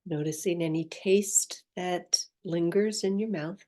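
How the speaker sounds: soft, unhurried meditation voice with long pauses; guided meditation. Acted. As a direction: gently guide the listener inward, leaving space after each phrase.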